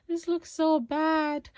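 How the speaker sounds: high, loud, slow laughing dismay; laughing at herself. Real, not acted. Vlogger laughing at her own bad makeup, high and loud. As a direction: laugh in embarrassed disbelief. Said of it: "this looks so terrible".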